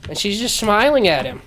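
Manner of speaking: usual speaking voice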